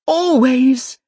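always, punched loud and defiant. Acted. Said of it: "with unshakable defiance".

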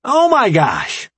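high, loud, drawn-out outburst; excited exclamation. A narrator's reading. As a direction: burst out in thrilled amazement, stretching it. Remy jumps up excited.

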